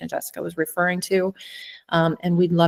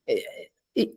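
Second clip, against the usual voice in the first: real catch in the throat, voice dropping away; voiceless, faltering, on the edge of tears; choked up; 6 semitones lower, 8 dB quieter than usual. Real, not acted. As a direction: stammer softly, too moved to get the words out. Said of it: "getting all choked up again".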